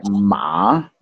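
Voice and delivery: "ma" with a valley in the middle; falling-then-rising "ma"; third tone. Shown as a demonstration. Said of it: "it's like a little valley".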